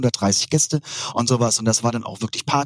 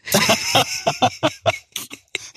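long, high, mostly voiceless laugh; real laughter; 12 semitones higher than usual. Real, not acted. Guest cracks up at host's bottom question, real laughter. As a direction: crack up for a couple of seconds.

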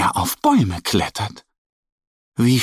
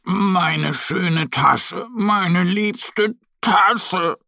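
slow, higher, tearful wail over the torn bag; weeping; 7 semitones higher, much slower than usual. A narrator's reading. Little bear mourns his torn favourite bag, slow teary lament. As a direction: draw it out like a little bear about to cry. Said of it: "weint Mo".

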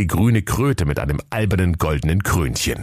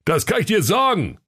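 higher, worked-up boss calling out; upset shout; 13 semitones higher than usual. Acted. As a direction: bark it out, fed up. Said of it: "rief Lukas ... ganz aufgebracht".

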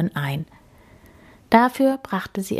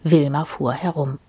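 usual speaking voice

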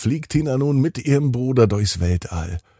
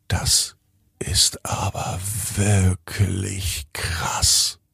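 geflüstert; 4 dB quieter, much slower than usual. Acted. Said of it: "denkt sich Tina".

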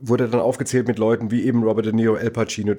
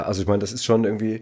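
ordinary delivery

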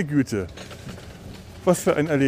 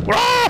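scream; 5 dB louder than usual. Real, not acted. Screaming at start of ride.

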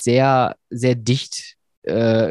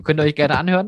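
ordinary talk, nothing marked

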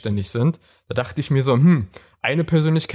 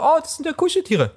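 put-on high girly voice. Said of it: "für mich sah das jetzt nur so aus wie eine Frau, so".

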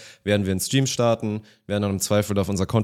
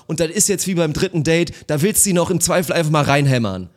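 Kommentatorzitat; 6 semitones higher than usual. Retold or imitated. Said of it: "sagt er".

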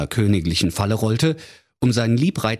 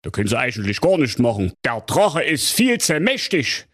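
Kommissar Bärbel Ehrlicher; 11 semitones higher than usual. Acted. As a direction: drag it out like a smug small-town cop. Commissioner gives up.

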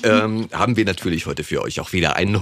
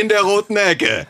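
lucha libre announcer; 11 semitones higher than usual. Acted. Ring announcer intro continues.

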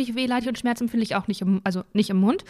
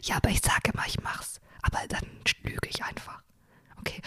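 hushed whisper, barely voiced; whispered confession; 10 dB quieter than usual. Real, not acted. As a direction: whisper it like a guilty secret. Confessing she lies to dentist.

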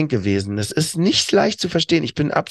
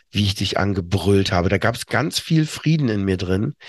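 ordinary talk

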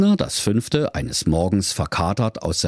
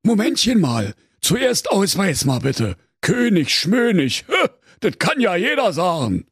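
high-pitched Berlin cop, scoffing; high, officious put-down; dismissive interrupting commissioner; 11 semitones higher than usual. A narrator's reading. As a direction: cut in and mock his title. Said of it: "unterbrach ihn".

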